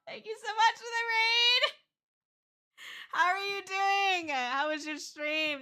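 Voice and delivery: very high, loud squealing greeting; excited raid welcome. Real, not acted. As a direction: giddily welcome incoming guests with laughter.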